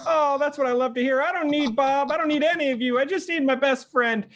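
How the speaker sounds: very high-pitched, gushing character voice; high, devoted little character; delighted Wardy. Acted. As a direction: gush happily in a high voice. Plays Wardy, delighted by friendship.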